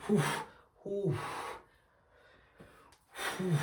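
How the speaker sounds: whew, whew, trying to calm down; calming exhales. Real, not acted. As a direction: blow out air to settle the anger. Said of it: "I wanted to calm down".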